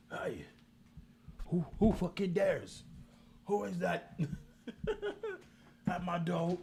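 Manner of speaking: barking voice. Retold or imitated. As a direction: play the barking pet bursting out. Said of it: "start barking".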